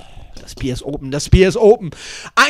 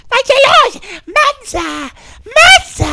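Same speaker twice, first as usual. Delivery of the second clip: very high, strained cartoon voice; high squeal calling Mansa; squeaky Winnie voice. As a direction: shriek out Mansa's name in a squeaky put-on voice. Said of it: "da kommt Winnie".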